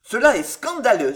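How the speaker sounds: loud, scandalised gentleman; mild outraged burst; indignant exclamation. A narrator's reading. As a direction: cry out in affront. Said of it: "s'écria-t-il".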